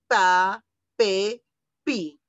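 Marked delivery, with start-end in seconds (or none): none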